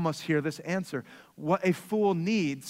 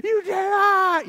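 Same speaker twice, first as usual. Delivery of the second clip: much higher, mock whining kid voice; high whiny tantrum voice; imitating whiny child; 11 semitones higher, 3 dB louder, slower than usual. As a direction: mimic a child having a tantrum. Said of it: "crying and screaming".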